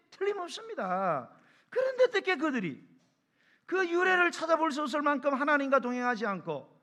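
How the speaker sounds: high, loud, impassioned sermon; full-force preaching. Real, not acted. Preacher asking how that generation could forsake God, impassioned and loud. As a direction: cry out the indignant questions to the whole congregation.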